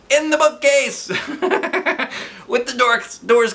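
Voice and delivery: high, loud laughing. Real, not acted. Reader laughing at partner's answer, high laugh. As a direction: crack up at it.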